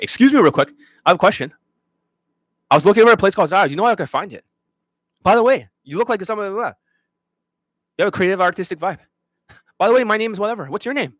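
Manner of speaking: high, rushed, breathless pickup patter; mock fast-talking approach. Coach mocking his students hitting on a woman, high and fast. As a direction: rattle it off high and fast like a nervous guy chatting up a stranger. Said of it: "this is how they talk".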